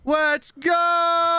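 loud high echo yell; hype shout. Real, not acted. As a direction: yell back with the same hype.

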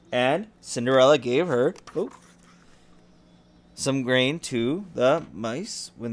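lively storybook reading; story narration. A narrator's reading. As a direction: read it like a bedtime story. Reads fairy tale to child.

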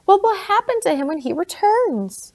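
high, loud crying wail; sobbing wail of a frightened girl. Acted. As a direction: cry it out in despair. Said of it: "she wailed".

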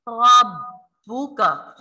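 रब्बुका with a firm, held double ब; doubled ba. Shown as a demonstration. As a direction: hold the ब्ब in रब्बुका firmly. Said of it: "सख्ती और जमाव के साथ".

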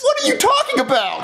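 very high, incredulous squeal; squeaky, exasperated bewilderment; baffled protest. Acted. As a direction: protest in disbelief. Falsely accused, baffled.

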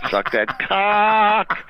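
high, slow drawn-out puppet voice; mocking puppet taunt. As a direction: stretch it out like the puppet. Puppet repeats, slower.

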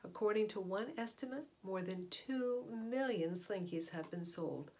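usual speaking voice

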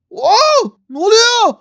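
loud high burst of surprise; shocked shout. Real, not acted. Gamer yelling at a sudden scene in shock. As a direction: yell it out in shock.

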